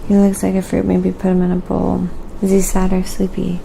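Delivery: ordinary talk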